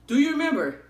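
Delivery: high, loud eager questions; hammed-up desperate recall; mock high asking the fireman; frantic recognition. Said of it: "And I was like".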